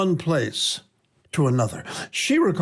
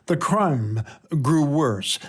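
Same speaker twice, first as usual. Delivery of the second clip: ordinary delivery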